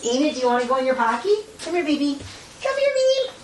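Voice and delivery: high, cooing pet voice. Real, not acted. Owner coaxing her pet Enid into a pocket, baby talk. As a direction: coax the little pet sweetly.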